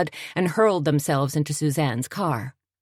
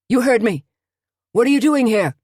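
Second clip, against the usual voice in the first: loud, higher furious yell; angry shout; 8 semitones higher, 7 dB louder than usual. A narrator's reading. Angry man chasing trespassers off his porch, shouting. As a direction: shout them off your property. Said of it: "the man shouted again".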